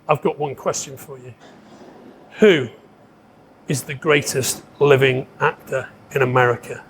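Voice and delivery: low, deliberate question with long pauses; grave challenge. Retold or imitated. Actor testing his interviewer, grave and deliberate.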